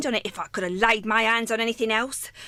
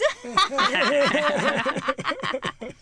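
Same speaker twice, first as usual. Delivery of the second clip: a mean cackle over the spoils; gloating cackle. Acted. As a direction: cackle with greedy glee. Charwoman cackles after gloating.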